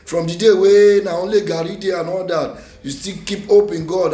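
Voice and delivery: ordinary delivery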